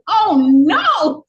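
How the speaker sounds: dismayed cry. Retold or imitated. Teen hears the nun refuse to take her, dismayed loud cry. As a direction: let the hope drop out in a cry.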